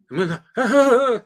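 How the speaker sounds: a quick, high burst of laughter; short high laugh. Real, not acted. Laughing at his own panic story, high laugh.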